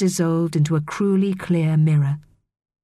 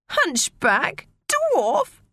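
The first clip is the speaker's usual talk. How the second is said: mock scream of outrage; 11 semitones higher, much slower than usual. A narrator's reading. Maria hears herself insulted by a mermaid, squealing in outrage. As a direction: squeal each word as an outraged question. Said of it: "screamed Maria".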